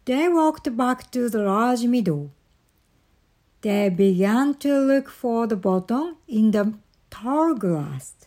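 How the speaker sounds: ordinary delivery, nothing marked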